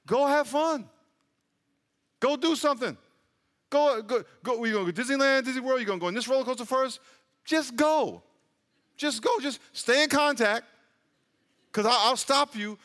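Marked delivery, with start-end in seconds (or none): 0.1-10.7 higher, lively, encouraging push; God's cheerful urging. Retold or imitated. God telling believers to go enjoy life, higher and lively. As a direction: urge them on like an excited friend. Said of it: "when God says".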